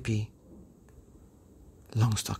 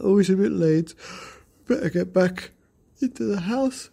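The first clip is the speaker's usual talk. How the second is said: put-on lightly raised child voice; kids' voices; 7 semitones higher, 3 dB louder than usual. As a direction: hurry home before mum checks. Children decide to leave.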